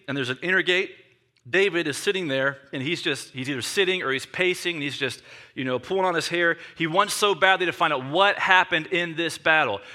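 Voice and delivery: ordinary delivery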